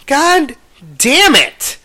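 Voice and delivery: high, exasperated groan of a protest; frustrated outcry. Retold or imitated. Telling how the price jumped, high frustrated outburst. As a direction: outbid at the last second and fed up. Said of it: "And I'm like".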